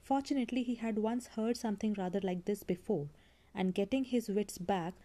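plain reading voice

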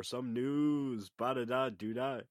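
sung jingle in a bassy voice; bass boosted. Real, not acted. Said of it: "this is the upside of being sick".

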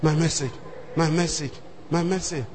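soft, low pleading repeat of my message; murmured panicked plea; quiet desperate prayer. Retold or imitated. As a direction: beg under your breath. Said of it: "I was praying".

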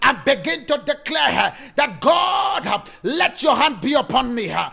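fiery shouted sermon; preaching at full force. Acted.

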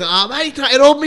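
high, loud indignant yell; hammed-up angry shout; mock outrage. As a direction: play the furious neighbour. Angry resident retold.

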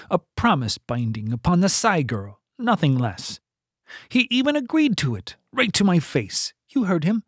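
pinched voice. A narrator's reading. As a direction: tight and squeezed, climbing fast. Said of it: "said in a pinched voice".

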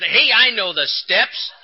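slow, loud, indignant retort with a laugh; mock-angry snap that breaks into a laugh; got mad. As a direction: bristle back at her, half laughing. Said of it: "I got mad".

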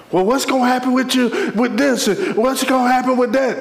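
high, insistent questioning; pressing questioner. Acted. People pressuring you for answers, high and insistent.

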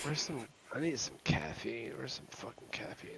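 a breathy muttered aside; hushed mutter about caffeine; whispered grumble. Real, not acted. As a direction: whisper it to himself, needing caffeine. Muttering while grabbing caffeine.

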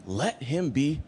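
pause, then whisper the final word; hushed, grave verdict; ominous whisper. Acted. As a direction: fall away to a whispered curse. Repeated curse, dropped to a whisper.

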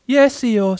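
high little voice; small, high, sorry answer; Piglet, a little tearful. A narrator's reading. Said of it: "sniffing a little bit".

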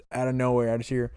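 ordinary delivery

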